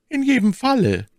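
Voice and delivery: high, earnest lady's voice. A narrator's reading. My Lady refuses to spare Ward, in earnest. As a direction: insist gravely, pitched well up. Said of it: "sprach My Lady ernst".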